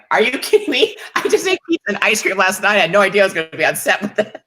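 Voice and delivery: high, whiny disbelief, quickening at the end; mock disbelief. Guest replaying her reply to the director, high mock protest. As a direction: squeal it like you can't believe the ask.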